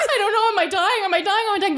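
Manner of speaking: high, repeated panicked questions; mock hysterics. Mother acting out the panic in the car, mock hysterics. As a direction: imitate frantic squealing through a laugh. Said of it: "She's hysterical".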